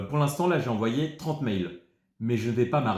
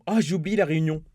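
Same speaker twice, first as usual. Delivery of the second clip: mock dismay; 5 semitones higher, 4 dB louder, faster than usual. Mock realization, repeated louder.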